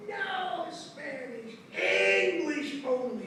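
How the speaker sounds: long drawn-out imitated teacher's command; teacher's yell. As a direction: stretch each word like a scolding teacher. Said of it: "she yelled".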